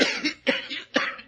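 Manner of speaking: put-on a rough cough from the old fellow; bad cough. Old bibliophile coughing in the crowd by the house. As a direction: let the old man cough up the street. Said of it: "He's got a bad cough".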